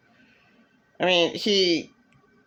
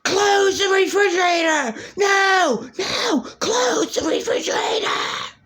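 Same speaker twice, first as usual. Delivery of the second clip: imitating screaming. Ex screaming at her to close the refrigerator. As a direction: scream it like the ex at the fridge. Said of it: "I was screamed at".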